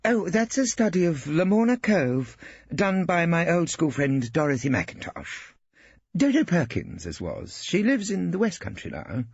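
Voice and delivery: high, prim wife; proud Hilda. A narrator's reading. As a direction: show off your friend's picture. Hilda explains the painting.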